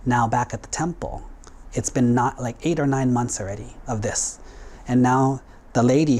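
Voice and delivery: ordinary talk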